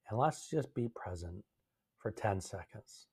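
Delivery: hushed, slowed invitation to be present; quiet guidance. Real, not acted. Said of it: "let's hold space quietly".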